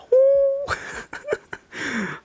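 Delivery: high, falling, giddy laugh; giddy high-pitched laugh; high squealing laugh. Real, not acted. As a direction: squeal with delight. Emotional excitement at power-on.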